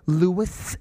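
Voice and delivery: nagging singled-out name, rising at the end; imitated needling. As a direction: draw it out to hound him. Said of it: "someone kept going".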